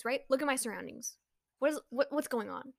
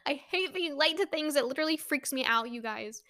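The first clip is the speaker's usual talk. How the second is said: ordinary talk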